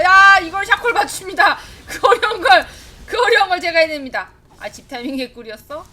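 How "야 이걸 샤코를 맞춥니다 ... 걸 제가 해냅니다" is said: loud, laughing self-praise in formal speech; laughing mock-formal bragging; mock-proud boast. Real, not acted. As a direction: brag like announcing your own feat. Boasting in formal announcer endings.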